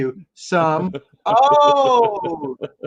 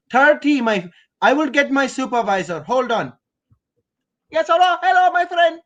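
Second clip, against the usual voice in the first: put-on Indian call-center agent; hammed-up Indian accent, patient helpdesk instructions; 3 semitones higher than usual. Shown as a demonstration. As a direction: play tech support with a thick Indian accent. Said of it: "Indian's the one I feel comfortable with".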